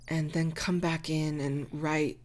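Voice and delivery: ordinary talk, nothing marked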